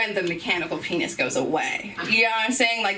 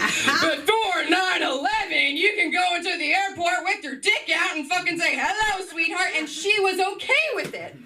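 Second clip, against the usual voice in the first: mock boomer rant; 8 semitones higher than usual. Boomer parents' view, mocked loudly.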